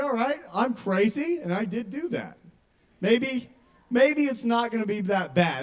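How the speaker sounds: high-pitched, hopeful self-talk; upbeat, high acceptance; tentative hopeful kid. Retold or imitated. Acts out accepting the teasing.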